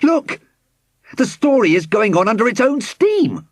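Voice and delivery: high-pitched alarmed amazement; astonished cry. A narrator's reading. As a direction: call out in sudden astonishment. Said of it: "he cried in a suddenly altered voice".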